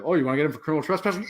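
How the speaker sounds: quick checking question; fast, rising check of someone's demand; retold query. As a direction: rattle it off as a question. Retold cop checks guard's demand.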